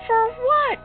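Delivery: high, loud, incredulous; startled question. Acted. Father learning the sandwich was traded away, startled and loud. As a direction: shoot the pitch up in disbelief.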